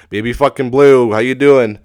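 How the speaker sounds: loud, high excited greeting; excited shout. Pretend. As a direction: yell it out like greeting an old buddy. Loud greeting to dead fish.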